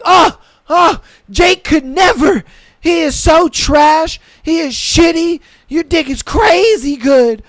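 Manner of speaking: high, loud hammed-up moans and praise.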